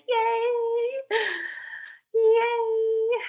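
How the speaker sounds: high cheer. Real, not acted. Cheering to end the plan.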